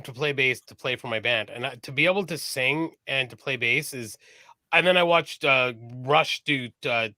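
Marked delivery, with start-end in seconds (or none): none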